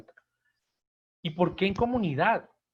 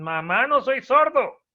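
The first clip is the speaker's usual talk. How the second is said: much higher, louder, slow protest; exasperated kid; 9 semitones higher, 9 dB louder, slower than usual. Retold or imitated. Child tells his mother he heard her the first time, exasperated. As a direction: snap back at a nagging mother. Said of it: "le dicen".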